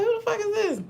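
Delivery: high mock-shocked mother; shocked mom. Mother seeing her newborn, imitated high and shocked. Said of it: "And she was like".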